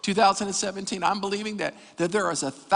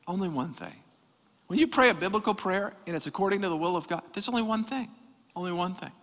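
ordinary delivery

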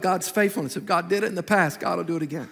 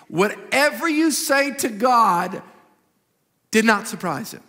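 usual speaking voice

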